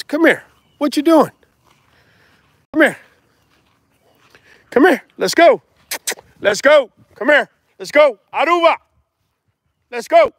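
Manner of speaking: high, loud repeated calls; calling the dogs. Real, not acted. Breeder calling his dogs to come inside, loud and high. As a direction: coax the dogs over, calling out.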